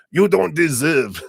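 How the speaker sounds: high, loud mock-German scolding; botched German accent that drifts toward French; bad German accent. Mind scolding the heart, in a high, hammed-up German accent. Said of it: "just imagine this is German".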